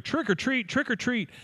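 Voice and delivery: high trick-or-treat chant; kids calling out. Retold or imitated. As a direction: call it out high like kids at the door. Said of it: "you start hearing".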